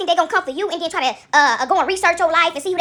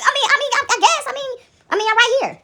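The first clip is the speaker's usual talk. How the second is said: incredulous; 7 semitones higher than usual. Real, not acted. Incredulous laugh at bullying.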